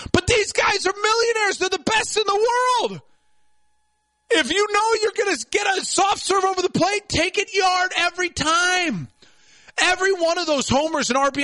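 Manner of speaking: shouting rant. Real, not acted. Ranting about millionaire players.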